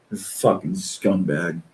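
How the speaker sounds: ordinary talk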